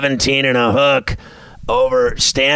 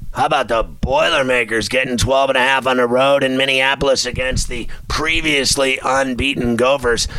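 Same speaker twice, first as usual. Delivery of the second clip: ordinary delivery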